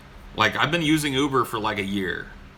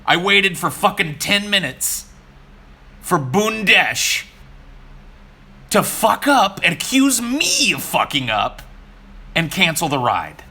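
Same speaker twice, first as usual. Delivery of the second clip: higher, louder, slow angry rant; 8 semitones higher, 6 dB louder, slower than usual. Real, not acted. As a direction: vent the fury word by word. Ranting about the cancelled ride.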